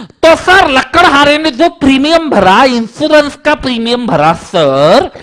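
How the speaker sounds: high, loud student insisting, drawn out; eager student Champat. Retold or imitated. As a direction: argue the point like a stubborn pupil. Student Champat arguing with teacher. (ज़िद करता छात्र, ऊँची आवाज़ में)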